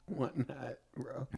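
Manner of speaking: soft teasing whisper; soft whispered joke; whispered tease. Real, not acted. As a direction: whisper it slyly.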